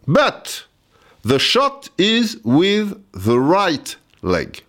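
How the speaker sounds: put-on very slow, high French-accented English; broken French-accented English. Explaining the penalty to Thomas, slow French-accented English. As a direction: labour through each English word like a French schoolboy. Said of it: "avec mon anglais à deux balles".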